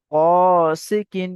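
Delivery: slow, long-held chanted reading of the word; drawn-out recitation. Shown as a demonstration. Teacher chanting a word slowly for students. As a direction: stretch each syllable like Quran recitation. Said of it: "তারপর উচ্চারণ করলেন".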